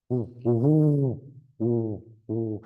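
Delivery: slow, hollow hooting ooh, ooh; owl hoot. Shown as a demonstration. Birder demonstrating the owl to listeners, slow owl hoot. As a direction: hoot like a great horned owl. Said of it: "here's my gray horned owl".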